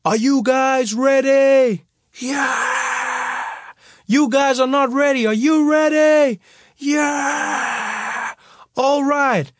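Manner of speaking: high, loud party-hyping call to the crowd; hype MC shout. Retold or imitated. DJ hyping the school hall crowd, loud MC shout. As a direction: pump up the crowd like an MC. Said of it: "I got on the mic".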